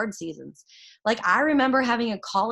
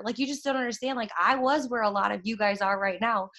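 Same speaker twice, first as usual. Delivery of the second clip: ordinary delivery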